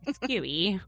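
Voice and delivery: ordinary talk, nothing marked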